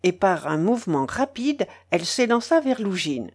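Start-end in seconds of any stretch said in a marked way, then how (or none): none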